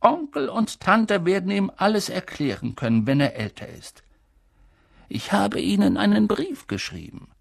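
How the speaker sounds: put-on calm, thoughtful old headmaster; hint of a wise old man musing; lightly voiced old wizard. A narrator's reading. Old headmaster answering the professor calmly.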